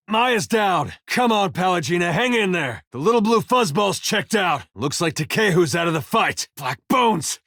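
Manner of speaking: high, strained battlefield calls; urgent combat alarm. Acted. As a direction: warn the party over the fighting. Reports casualties mid-fight.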